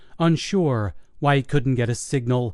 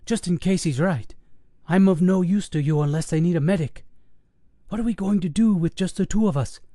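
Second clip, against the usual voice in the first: higher, nervous pleading questions; worried paramedic; 6 semitones higher than usual. Acted. Paramedic urging the officer to wait for backup, worried. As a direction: question him with growing worry.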